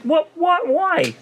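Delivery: a tired traveller's disbelief at being woken again; mock exasperated squeal of disbelief. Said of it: "And I was just like".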